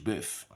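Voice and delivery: bœuf said the French way, rounded vowel; French pronunciation. Shown as a demonstration. Teacher models the French origin of beef, French pronunciation. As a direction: give bœuf its French sound. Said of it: "From French".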